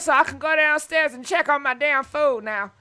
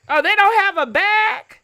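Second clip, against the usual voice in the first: surprised outcry; 6 semitones higher, 6 dB louder than usual. Real, not acted. Reacting to delivery problem.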